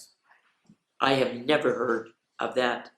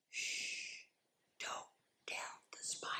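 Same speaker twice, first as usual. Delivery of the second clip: soft unvoiced whisper; breathy secret whisper; 22 dB quieter than usual. Shown as a demonstration. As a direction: hush it like a secret. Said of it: "So you get to whisper it".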